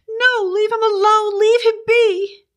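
high, distraught woman's cry; shrieky, desperate plea; frantic pleading. A narrator's reading. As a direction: beg them off in a very high voice. Betty fights off the fog, pleading.

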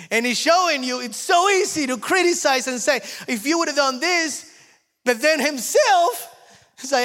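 high, loud, fervent preaching; preaching at full force. Real, not acted. Preacher builds to full force before the congregation, high and loud. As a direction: drive the point home to the congregation.

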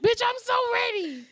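high, loud hyped squeal; excited shout. Retold or imitated. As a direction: shriek it out with pure hype. Hyped reaction continues.

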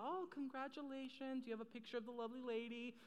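soft, courteous shop-clerk voice; lightly voiced salesclerk.

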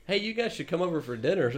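high, giggly neighbor invite; friendly neighbor. Retold or imitated. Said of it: "they're like".

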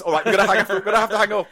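high, loud, hurried cut-off; hammed-up panicky sign-off; mock flustered host. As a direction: imitate a presenter dropping a caller. Acting host cutting off caller.

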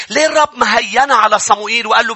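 ordinary delivery

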